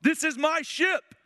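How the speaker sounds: high, loud defiant yelling; pirate shouting back. Retold or imitated. Somali pirate yelling at Tom Hanks that he has the gun, loud and high. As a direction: scream that you hold the gun. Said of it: "And the guy's like".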